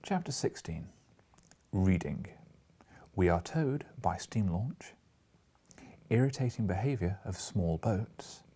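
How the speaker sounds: steady read-aloud chapter heading; story narration. A narrator's reading.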